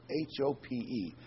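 slow letter-by-letter spelling of H O P E; spelled out. Shown as a demonstration. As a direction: spell out H O P E slowly, each letter apart. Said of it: "And Paul emphasizes hope".

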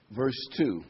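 usual speaking voice

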